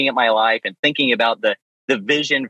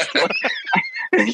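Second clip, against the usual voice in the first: high laugh; 10 semitones higher than usual. Real, not acted. Laughs at his own story.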